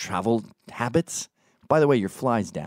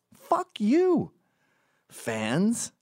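high-pitched exasperated outburst; indignant curse; 12 semitones higher, much slower than usual. Retold or imitated. Cursing the fans who film him, high indignant outburst. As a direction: spit out the curse, voice shooting up. Rant at fans' questions.

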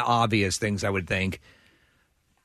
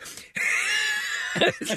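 very high-pitched imitated scream; hammed-up panicked squeal; mock frantic shriek. Agent tearing his wig off for a cop, mock shriek.

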